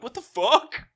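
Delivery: high, loud mock disbelief; baffled outburst. Host voicing Damon reacting in disbelief, mock shock. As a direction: blurt it out, stunned. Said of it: "And Damon's like".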